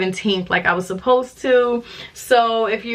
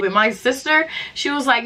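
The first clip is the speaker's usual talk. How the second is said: usual speaking voice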